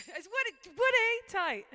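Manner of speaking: loud high call across the room. Real, not acted. Singer calling her brother's name out loud. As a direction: shout his name to get his attention.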